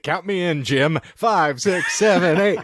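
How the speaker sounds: high, showy dance count-in; hammed-up musical number lead-in; Bob Fosse. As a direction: count the troupe in like a choreographer. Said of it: "we got the Bob Fosse".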